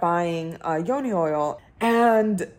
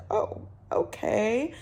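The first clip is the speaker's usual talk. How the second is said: mock dismissive; 2 semitones higher, 7 dB quieter, slower than usual. Mocking the offended women again.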